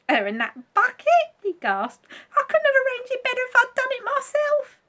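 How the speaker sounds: a giggling mischief-maker; monkey voice. Acted.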